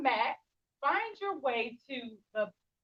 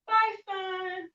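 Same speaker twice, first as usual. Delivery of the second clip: lifted, louder farewell to the sun; sing-song goodbye; 7 semitones higher, 4 dB louder, much slower than usual. Shown as a demonstration. Teacher leading kids to say goodbye to the sun. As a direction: wave the sun off brightly. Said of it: "I'm gonna say".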